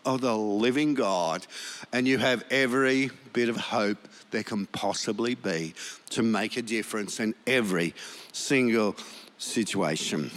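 normal talking voice

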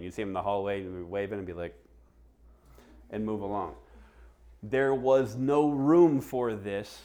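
usual speaking voice

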